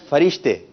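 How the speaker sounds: "فرشتے" as fa-rish-te, falling; second pronunciation option. Shown as a demonstration. Quizmaster offering the second choice, fa-rish-te pronunciation. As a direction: say "فرشتے" with short a first, tune dropping. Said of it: "آپ نے درست تلفظ بتانا ہے".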